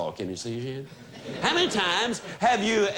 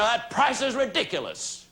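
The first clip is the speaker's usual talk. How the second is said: higher-pitched mock complaint; objecting prospect; 5 semitones higher than usual. Prospect objecting to a salesperson, raised-pitch protest. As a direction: play a prospect balking at the price. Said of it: "When the prospect says to you".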